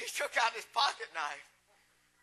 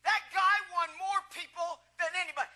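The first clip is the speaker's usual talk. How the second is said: ordinary talk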